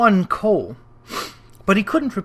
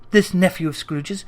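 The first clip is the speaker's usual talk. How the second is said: ordinary delivery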